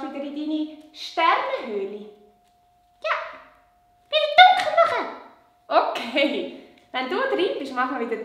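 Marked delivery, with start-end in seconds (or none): 3.0-5.1 high, louder puppet urging; excited puppet voice. Acted. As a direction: hurry him in a high puppet voice. Puppet Lola.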